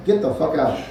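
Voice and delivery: ordinary delivery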